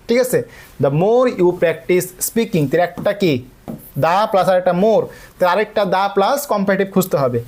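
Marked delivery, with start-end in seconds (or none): 0.8-2.6 each word drawn out slowly for students; teacher spelling out an exam item at half speed; slow dictation. Shown as a demonstration. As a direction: read the test sentence very slowly, word by word. Dictating quiz sentence slowly.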